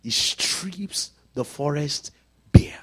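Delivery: very slow, word by word; slow emphatic repeat. Shown as a demonstration. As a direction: draw out STRIPS, pause before BARE. Repeats verse slowly for emphasis.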